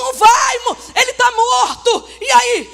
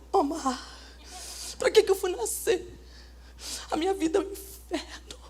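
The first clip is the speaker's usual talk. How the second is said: low, much softer whimpering complaint; mock lament; 7 semitones lower, 14 dB quieter than usual. Pastor mocking a believer who calls life hell, whimpering. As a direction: sob out the misery, hamming it up.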